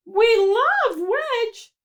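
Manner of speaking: high, slow, swooning crowd voice; mock crowd gush. Envious cheese mimicking the crowd's gushing over Wedge. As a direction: ham up the adoring fans.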